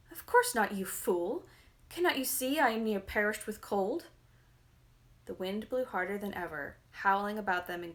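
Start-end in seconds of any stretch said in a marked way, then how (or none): 0.1-4.1 rude angry retort. A narrator's reading. Spoilt girl snaps at Frost.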